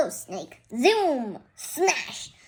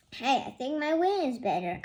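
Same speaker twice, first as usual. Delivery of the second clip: plain reading voice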